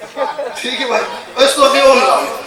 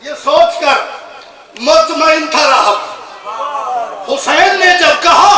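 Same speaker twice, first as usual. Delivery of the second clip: raised pitch, measured poetic delivery; declaimed couplet with pauses between phrases; majlis verse recitation; 5 semitones higher, 3 dB louder, slower than usual. Acted. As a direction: recite in held phrases, lifting at the end.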